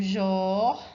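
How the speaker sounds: JOR held out on its own; scanned syllable. Shown as a demonstration. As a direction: stretch "jor" as one counted syllable. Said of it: "última sílaba tônica, vou fazer aqui".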